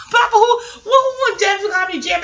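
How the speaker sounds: high, loud call across the room. Real, not acted. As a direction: shout the question over to papa. Calls out to her papa.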